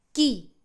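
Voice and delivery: "Chi" said as kee; Italian pronunciation. Shown as a demonstration. Teacher drilling "who" in Italian, "Chi" as kee. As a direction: say "Chi" short, with a hard k. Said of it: "todos los ejemplos sin interrupciones".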